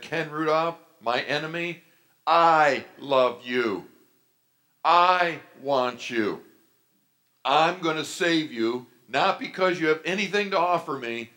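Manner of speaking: emphatic preacher voicing a divine promise; impassioned voice of God. Voices God claiming him at conversion.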